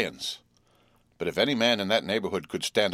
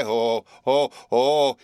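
loud high shrieking oh's; shrieks; 4 semitones higher, 6 dB louder, much slower than usual. A narrator's reading. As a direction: shriek each oh louder. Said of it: "Each of these exclamations was a shriek".

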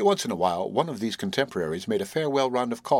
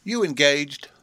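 higher-pitched blunt question; demanding; 5 semitones higher, 4 dB louder, slower than usual. A narrator's reading. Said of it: "demanded Marilyn".

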